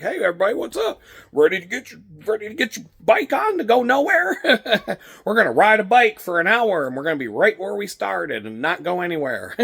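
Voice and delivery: high, loud, bubbly class-opener voice; peppy spin instructor. Retold or imitated. Greeting the spin class before the ride, high and peppy. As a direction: hype the room like a fitness instructor.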